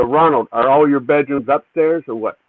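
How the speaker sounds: normal talking voice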